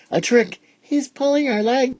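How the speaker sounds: put-on high excited kid voice; a gleeful child piping up; child's voice. A narrator's reading. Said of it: "the children were telling him".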